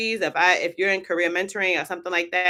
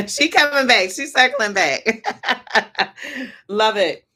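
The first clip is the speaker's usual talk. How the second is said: higher, louder giggly teasing; laughing; 5 semitones higher, 7 dB louder than usual. Real, not acted. Teasing the viewer who deleted her question, laughing. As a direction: tease through laughter.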